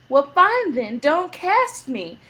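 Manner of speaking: high, slow sassy retort; mock defiant huff. Student defying the faculty, high sassy huff. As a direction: toss it back with fake pride. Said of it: "And I was like".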